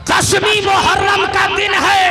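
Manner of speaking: loud, high, ringing sermon climax; fiery preaching. Acted. Preacher roaring to the gathering, loud and high. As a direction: preach it out to the crowd at full force.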